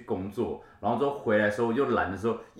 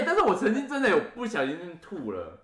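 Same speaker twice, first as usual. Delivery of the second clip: usual speaking voice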